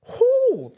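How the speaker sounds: sudden high whooping cry; loud whoop. Real, not acted. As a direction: let it all out with a whoop. Emotional release, apologizes after.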